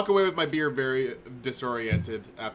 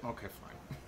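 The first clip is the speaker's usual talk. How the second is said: grudging whisper; 14 dB quieter than usual. Real, not acted. Player concedes the retcon.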